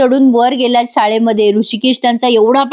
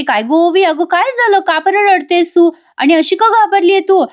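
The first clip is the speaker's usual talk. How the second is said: high, anxious questioning; a mother alarmed to find her child crying; worried mother; 7 semitones higher than usual. Retold or imitated. Janaki asking crying Ovi, high worried questions. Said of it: "Janaki विचारते".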